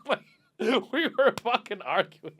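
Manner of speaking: ordinary delivery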